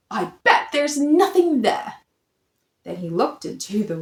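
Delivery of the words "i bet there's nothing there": usual speaking voice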